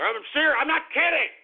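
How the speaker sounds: high-pitched, exasperated protest; heated insistence. Real, not acted. Host swearing he means it, heated exasperated insistence. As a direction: insist hotly, voice jumping up.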